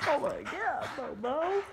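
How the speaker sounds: very high voice to the dog; high pet-talk exclamation. Real, not acted. Owner reacting to Bobo's antics, high pet talk.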